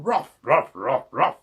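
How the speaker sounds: loud gruff ruff barks; dog ruff. Acted. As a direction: bark ruff ruff. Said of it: "said Muffin McClay".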